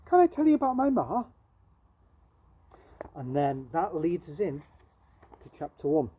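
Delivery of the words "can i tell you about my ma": quick, higher, louder eager question; a street boy eager to talk about his ma. Acted. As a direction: rush it out, keen to tell. Jim eagerly asks Barney.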